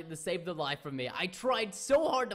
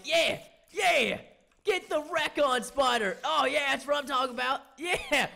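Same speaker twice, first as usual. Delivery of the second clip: triumphant; 6 semitones higher, 4 dB louder than usual. Real, not acted. Winning the fight.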